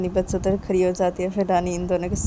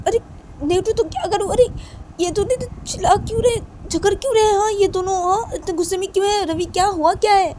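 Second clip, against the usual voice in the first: put-on much higher girlish character voice, agitated; Rani, exasperated; 13 semitones higher, 5 dB louder than usual. Rani asks Ravi why the two are fighting, agitated. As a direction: fire off alarmed questions in a high voice.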